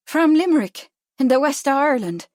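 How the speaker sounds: high, loud Irish brogue. A narrator's reading. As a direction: answer in a broad Irish accent. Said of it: "her own Irish brogue".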